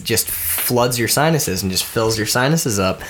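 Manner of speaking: ordinary talk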